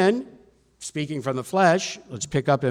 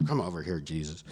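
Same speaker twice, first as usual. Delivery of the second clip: low, soft acted aside, dropping away; hushed aside; 8 semitones lower, 8 dB quieter than usual. Peter pulls Jesus aside by the arm, low hushed aside. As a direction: take someone aside and keep it down.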